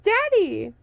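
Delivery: put-on high, loud child's cry; a small boy crying out for his father; child calling. A narrator's reading. Trapped son calling up to his father, high child's cry.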